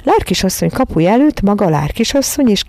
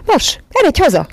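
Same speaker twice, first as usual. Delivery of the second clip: shouting; 10 semitones higher than usual. A narrator's reading. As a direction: yell to send the dog home. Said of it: "kiáltotta".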